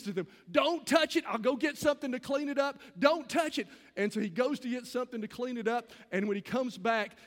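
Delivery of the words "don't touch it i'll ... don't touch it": put-on raised, urgent warning to careless teens; a son shouting at the boys not to touch the paint, urgent. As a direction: call out higher and louder, urgent.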